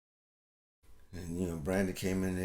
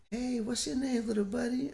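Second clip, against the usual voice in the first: put-on much higher, sing-song talk to a little kid; sweet coaxing adult. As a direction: coo at a shy child to get a word out. Relatives coaxing shy child.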